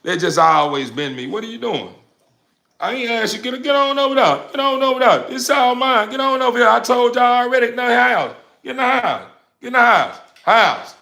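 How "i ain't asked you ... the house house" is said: high, insistent repeated commands; scolding the dogs. Real, not acted. Owner orders the dogs into the house, high scolding. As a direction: herd them inside with sharp orders.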